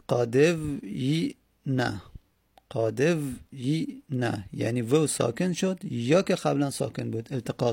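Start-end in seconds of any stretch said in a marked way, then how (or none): 0.1-4.5 "قادو یی نه" slow, in three separated pieces; sounded out. Shown as a demonstration.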